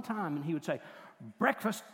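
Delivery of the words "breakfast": loud, higher one-word call; gruff wake-up call. Retold or imitated. Grandfather waking a sleeping grandchild, called out loud. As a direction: call it out once from the doorway. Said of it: "he would come in one time to the back room".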